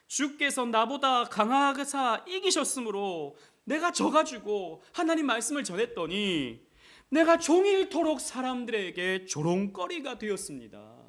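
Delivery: slow, high, loud verse reading; fervent scripture paraphrase. Acted. Preacher voicing Jeremiah's complaint, slow, high and loud.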